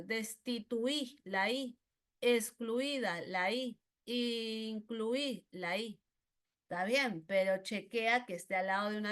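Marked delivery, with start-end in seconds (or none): 0.0-6.0 slow, drawn-out examples; slow demonstration. Shown as a demonstration. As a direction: spell each word out slowly. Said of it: "la I también suena fuerte". (ejemplos lentos y marcados)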